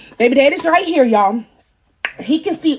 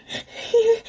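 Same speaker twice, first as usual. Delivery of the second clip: high soft giggle; real little high giggle; laugh; 8 semitones higher, 4 dB quieter than usual. Real, not acted. Storyteller laughing at her own foolishness, high giggle.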